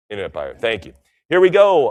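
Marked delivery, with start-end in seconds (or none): 1.3-1.9 excited. Real, not acted. Starts new lot.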